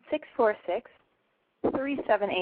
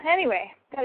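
ordinary talk

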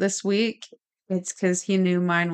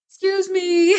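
high squeaky doll voice; talking doll; 12 semitones higher, 3 dB louder than usual. Pretend. Voicing the haunted doll.

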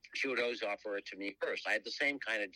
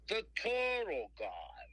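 put-on high, slow, drawn-out New Jersey accent; Jersey guy; 12 semitones higher, much slower than usual. Jersey caller introducing himself, high slow Jersey accent. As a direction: announce yourself like a proud New Jersey hustler. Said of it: "he talks just like a guy from Jersey".

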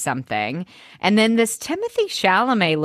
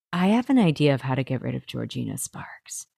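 mock scheming Wendy; 7 semitones lower, 4 dB quieter, faster than usual. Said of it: "Wendy is like".

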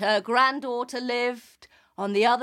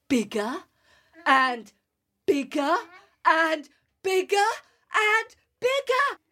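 building up; 5 semitones higher, 4 dB louder than usual. A narrator's reading. Building as she grows.

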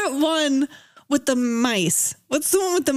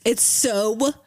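usual speaking voice

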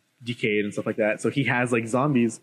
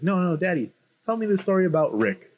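put-on high-pitched pleading child voice; little daughter; 6 semitones higher than usual. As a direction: imitate a little girl asking Daddy. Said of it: "his daughter puts on the hat".

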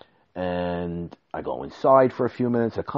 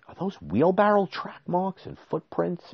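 much higher incredulous question; dismayed discovery; 11 semitones higher than usual. Retold or imitated. Inspecting the dried patio, high dismayed question. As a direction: spot the damage in disbelief. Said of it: "I said".

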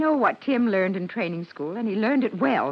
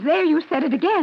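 alarmed; 7 semitones higher, 5 dB louder than usual. Acted. Mother hears son called Private.

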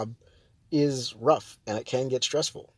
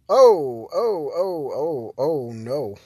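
startled oh no; 2 semitones higher, 5 dB louder, much slower than usual. Real, not acted. Driver's truck rolls away, a startled loud oh no. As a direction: caught off guard, blurting out alarm. Sees runaway truck while recording.

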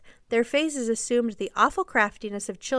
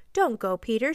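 ordinary delivery, nothing marked

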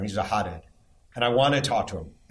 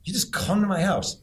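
incredulous athlete; 7 semitones higher than usual. Retold or imitated. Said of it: "He's like".

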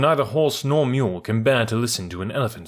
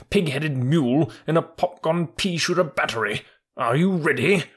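raised pitch, haughty indignation; offended troop horse; 6 semitones higher than usual. Acted. Troop horse challenging the mule over an insult, indignant. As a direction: build a proud challenge to a fight.

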